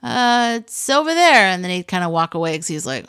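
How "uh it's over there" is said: mock child's dodge, higher and louder; a little boy brushing off the question; evasive child. Aunt imitating her nephew dodging the question, higher and louder. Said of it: "I saw him kinda go".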